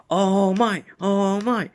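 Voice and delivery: high-pitched, put-on cooing of wonder; amazed onlookers. Crowd sees All Might change the weather, high-pitched amazement. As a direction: act out an astonished crowd.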